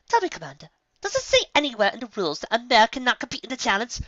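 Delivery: high, precise and cool; pointed question. A narrator's reading. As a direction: corner him politely. Rarity coolly questions the rules.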